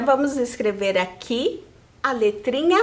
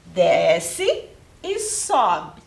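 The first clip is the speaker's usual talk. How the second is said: 1 slow tracing instruction; 5 semitones lower, much slower than usual. Shown as a demonstration. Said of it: "Pra fazer a letrinha V".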